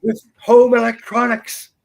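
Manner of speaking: mock war cry. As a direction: declare war as a store-tribe chief. Tribe chief declares war.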